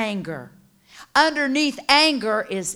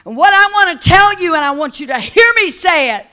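loud, impassioned sermon, building to a shout; full-force preaching; 6 semitones higher, 12 dB louder than usual. Real, not acted. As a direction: preach it hard, raised pitch, driving every phrase.

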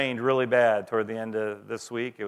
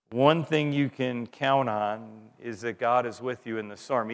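usual speaking voice

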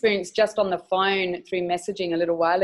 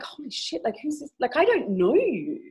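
pitched up, half-breathed shock at a stranger; shocked disbelief; 6 semitones higher than usual. Retold or imitated. To a stranger offering a massage, pitched-up shocked disbelief. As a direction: recoil as if a stranger overstepped.

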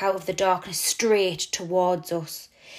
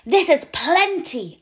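louder, higher call, slow; calling after; 8 semitones higher, 6 dB louder, slower than usual. A narrator's reading. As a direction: call after someone flying away. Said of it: "I call after Mousetrap".

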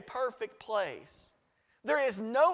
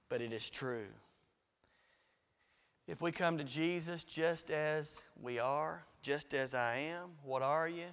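low, soft pulpit voice with long pauses; hushed preaching; 9 semitones lower, 4 dB quieter than usual. Real, not acted. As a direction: let it sink in quietly. Preacher drops to solemn hush.

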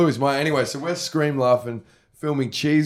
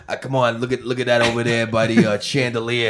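put-on New York accent; 2 semitones lower than usual. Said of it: "that accent was born".

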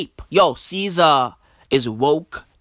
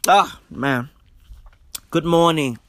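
ordinary delivery

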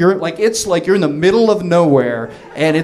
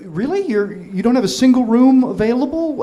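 mildly incredulous, higher-pitched question; disbelief; 7 semitones higher than usual. Retold or imitated. Would-be guest to hotel owner on the phone, incredulous. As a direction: question it in retold disbelief, voice lifted.

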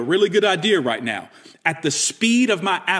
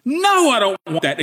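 say it with passion; 5 semitones higher, slower than usual. Shown as a demonstration. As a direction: refuse it boldly, like you mean it. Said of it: "And you say it with passion".

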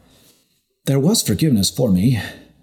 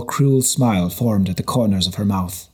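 plain reading voice

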